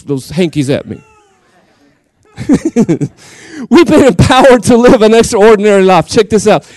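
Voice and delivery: ordinary talk, nothing marked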